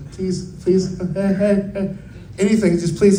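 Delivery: high begging with a nervous laugh; imitated desperate little plea; nervous pleading. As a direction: plead under your breath, then giggle anxiously. Begging mom not to blow up.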